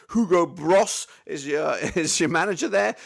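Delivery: slow, giggling through the manager's name; real laughter breaking into the words; laughing. Real, not acted. Host reading out the manager's name, cracking up with real laughter. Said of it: "I don't know why I'm laughing".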